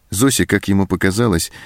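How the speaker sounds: usual speaking voice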